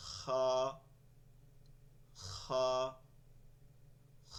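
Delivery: "খ" as a long throaty fricative; scraped Arabic kha. Shown as a demonstration. Teacher drills the Arabic letter, scraped kha. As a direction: scrape "খ" against the palate like clearing phlegm. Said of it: "তালুতে গিয়ে একটু ঘষবে".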